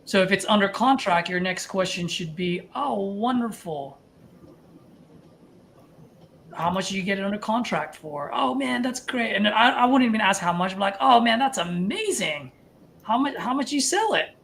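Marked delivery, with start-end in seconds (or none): 2.8-9.4 excited. Shown as a demonstration. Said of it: "You gotta get a little animated". 11.0-14.4 excited. Shown as a demonstration. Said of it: "I want the excitement".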